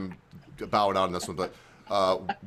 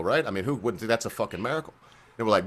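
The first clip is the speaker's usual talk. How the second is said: ordinary talk, nothing marked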